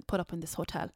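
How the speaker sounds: normal talking voice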